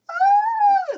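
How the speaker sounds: high, loud swooning "ah"; swooning squeal. Retold or imitated. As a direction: squeal like you're smitten. Maid swooning.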